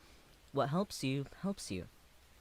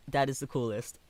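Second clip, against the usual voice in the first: ordinary talk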